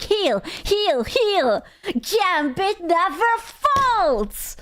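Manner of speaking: high, slowing cheer; excited callout. Retold or imitated. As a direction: chant heal like a hyped healer. Own in-game callout quoted.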